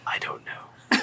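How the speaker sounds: amused unvoiced whisper. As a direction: whisper it back playfully. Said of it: "why are we whispering".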